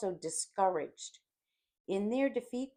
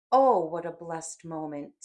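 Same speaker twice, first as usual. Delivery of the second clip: relishing; 8 dB louder than usual. A narrator's reading. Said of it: "smacked Lucifer".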